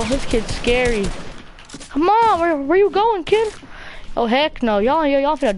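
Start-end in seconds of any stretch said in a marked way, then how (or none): 1.9-3.5 taunting call. Real, not acted. Teammate taunts a fleeing enemy kid, excited and high. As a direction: call him out, teasing and keyed up. Taunting an opponent mid-game.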